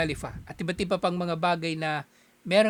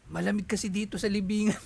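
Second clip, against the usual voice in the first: lightly put-on spirit voice from the grave, a bit higher; changed spirit voice; 3 semitones higher than usual. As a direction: play the dead grandfather explaining his odd voice, half-joking. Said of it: "Ba't iba yung boses mo?". (bahagyang ibang boses ng kaluluwa)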